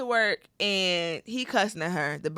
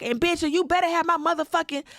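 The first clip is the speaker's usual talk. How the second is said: higher, louder landlord yelling for rent; mean landlord; 10 semitones higher, 4 dB louder than usual. Retold or imitated. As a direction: bark it like a furious landlord. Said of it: "Literally like this".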